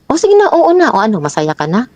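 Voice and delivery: high-pitched taunt, dropping at the end; exasperated mock concession; mocking retort. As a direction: imitate a partner giving in just to needle. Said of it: "para bang nang-aasar na".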